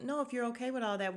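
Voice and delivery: ordinary delivery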